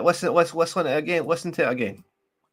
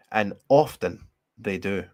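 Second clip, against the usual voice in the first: normal talking voice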